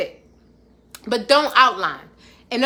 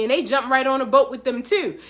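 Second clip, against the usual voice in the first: normal talking voice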